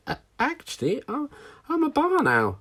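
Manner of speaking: high little owlet asking; squeaky young owl question; baby owl voice. Acted. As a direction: pitch it way up like a small owl chick. Plop stammers, winded.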